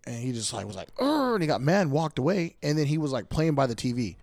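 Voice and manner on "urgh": high frustrated little grunt; a two-year-old's annoyed urgh; toddler angry grunt. Retold or imitated. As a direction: growl like a mad toddler. Toddler grunts angrily, imitated.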